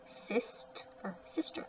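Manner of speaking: whispered Sister, soft; hushed Sister repeat. Shown as a demonstration. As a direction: breathe Sister with no voice. Teacher whispers the word.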